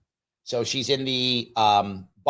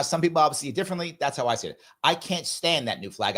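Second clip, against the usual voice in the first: ordinary talk, nothing marked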